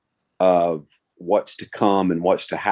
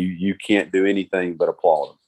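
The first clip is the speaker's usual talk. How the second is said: ordinary delivery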